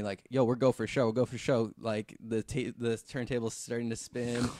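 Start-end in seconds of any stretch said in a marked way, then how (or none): 0.3-1.7 calling over the radio, a bit louder. Retold or imitated. Crew on radio telling him go for show, called out. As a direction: relay the go call like a crew on radio. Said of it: "my guys in my radio telling me".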